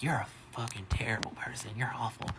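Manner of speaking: mock whispered insult. Guests insulting the bartender.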